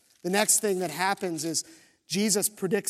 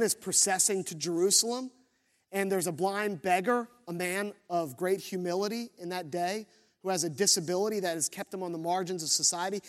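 ordinary talk

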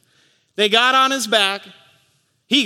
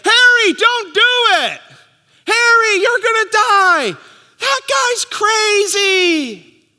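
high, loud panicked yelling; screaming crowd; 13 semitones higher, 6 dB louder, slower than usual. Retold or imitated. Pastor voicing the crowd at Niagara, screaming. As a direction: shout it up high like a horrified onlooker. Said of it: "the crowd start screaming".

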